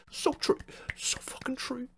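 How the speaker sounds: sarcastic hushed agreement; mock whisper. Mocking replies backing the troll, whispered. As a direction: breathe it out as a fake fan.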